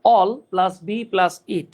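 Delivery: very slow, all plus be plus it spelled out word by word; syllable by syllable. Shown as a demonstration. Teacher to students, spelling out all plus be plus it very slowly. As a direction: break it into pieces for the class, very slowly. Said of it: "এটার উচ্চারণ হচ্ছে".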